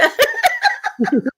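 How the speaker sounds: very high, loud cackle; odd high peal of laughter; weird laugh. Real, not acted. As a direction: let out a shrieking giggle. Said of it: "with a weird laugh at the end".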